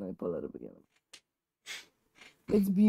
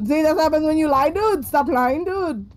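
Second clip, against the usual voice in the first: playful scolding; 7 semitones higher than usual. Real, not acted. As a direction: scold a friend jokingly.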